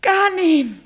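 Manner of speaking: loud, high call of a name. Acted. Bride calling out to Ghanem in the night, loud and high. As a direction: call his name across the dark.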